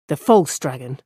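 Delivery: The false dragon, leaning on false; with emphasis. Acted. Rand correcting the farmer, stress on "false". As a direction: press hard on "false" to correct him. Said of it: "Rand said with emphasis".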